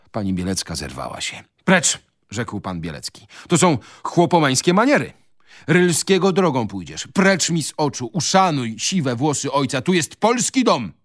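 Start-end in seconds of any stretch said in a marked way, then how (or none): 1.6-2.0 high, loud dismissal; angry command. A narrator's reading. Father throwing his son out, angry command. 3.5-10.9 high-pitched outraged tirade; angry rebuke. A narrator's reading. Father banishing son from his Polish home, angry rebuke.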